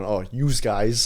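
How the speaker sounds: slow mock New Jersey accent. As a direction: drawl it like a Jersey local. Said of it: "we're from Jersey and going".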